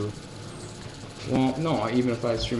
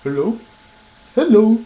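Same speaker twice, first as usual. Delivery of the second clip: much higher and louder goofy dog hello; dog's silly voice; 10 semitones higher, 10 dB louder than usual. Retold or imitated. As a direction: do the dopey dog's greeting, pitched up. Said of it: "he sounds like that too".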